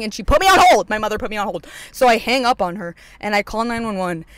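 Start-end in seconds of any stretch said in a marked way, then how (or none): 0.2-0.9 high, loud burst of indignation; exasperated outburst. Retold or imitated. Complaining that mom was no help, exasperated outburst. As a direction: blurt it out, outraged and raised. Outburst about mom's hold.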